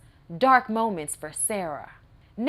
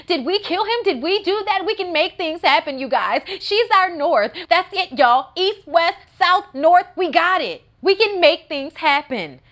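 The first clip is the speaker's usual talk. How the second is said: high, loud, giddy rush; breathless thrilled teen; excited Bonnie; 6 semitones higher, 5 dB louder than usual. Retold or imitated. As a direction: gush like she just did magic.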